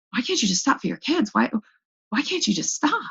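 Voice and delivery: imitating people nagging her to quit; exasperated people. People nagging the drinking mother, mock exasperated. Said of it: "I had people say that to me".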